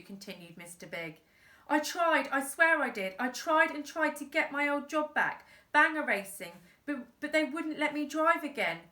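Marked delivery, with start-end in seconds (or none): 1.7-8.8 pleading dad. Acted.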